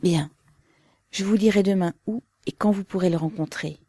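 ordinary delivery

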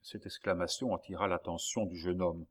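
plain reading voice

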